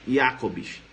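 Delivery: "Jacobs" as YA-cobs, J said as Y; German pronunciation. Shown as a demonstration. Teacher coaches students on the author's name, German pronunciation. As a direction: say "Jacobs" opening with "iá".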